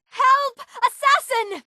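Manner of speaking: very high-pitched alarm call; panicked cry. Acted. Lady raising the alarm about an assassin, panicked high cry. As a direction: cry out for help in sheer panic. Character calls out for help.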